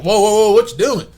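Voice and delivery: high, loud startled whoa whoa; a quick, startled shout of alarm; alarmed friends. Retold or imitated. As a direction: cry out to stop him in alarm.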